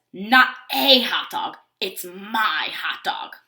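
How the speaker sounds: slow, with "a" and "my" punched; pointed correction landing on "my"; correcting. A narrator's reading. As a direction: stress "a", then stretch "my" long. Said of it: "So he corrects the little bird".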